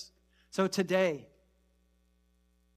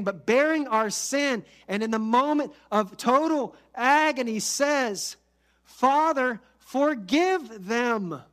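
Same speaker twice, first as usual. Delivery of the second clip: loud, higher, impassioned sermon climb; full-force preaching; 4 semitones higher, 4 dB louder than usual. Acted. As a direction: preach it with rising fervour.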